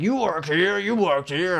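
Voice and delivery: high, loud insistent accusation; demanding customer. Retold or imitated. Customer insisting the clerk works here, loud and pushy. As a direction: press him like a pushy old man. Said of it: "he was like".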